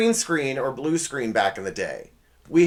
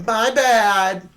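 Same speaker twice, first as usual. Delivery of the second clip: loud, high, insincere apology; sarcastic; 9 semitones higher, 7 dB louder than usual. Shown as a demonstration. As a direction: sing out a flippant mock apology. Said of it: "it's your sarcastic way of doing it".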